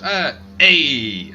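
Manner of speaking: loud greeting. Acted. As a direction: call out a big friendly hey. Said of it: "he just looks at you and goes".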